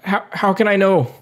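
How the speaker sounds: high, halting in-character question; anxious doubt. Acted. Acted doubt as the character Raven.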